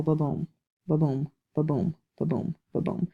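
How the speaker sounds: soft, separated "ba-boom" beats; iambic rhythm. Shown as a demonstration. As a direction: tap out each "ba-boom" quietly as an even pulse. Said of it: "that's a perfect example".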